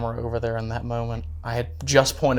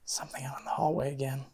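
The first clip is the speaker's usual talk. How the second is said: soft unvoiced whisper; 14 dB quieter than usual. Real, not acted. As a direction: whisper it so nothing hears. Hearing noises in hallway.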